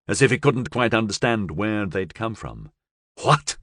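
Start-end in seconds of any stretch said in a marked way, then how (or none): 3.2-3.6 higher, abrupt gangster demand. A narrator's reading. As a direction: demand an answer. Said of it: "he demanded".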